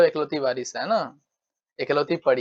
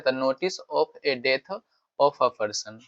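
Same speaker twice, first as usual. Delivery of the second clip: slow dictation; much slower than usual. Shown as a demonstration. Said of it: "slow dictation".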